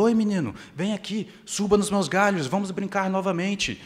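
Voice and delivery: a tree delighted its friend is back, calling him up; happy tree calling. Acted. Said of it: "ficou toda feliz, contente de novo e falou".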